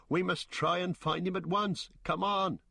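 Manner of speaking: high, urgent call; urgent hobbit. Acted. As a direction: hurry them to search. Sam urging search.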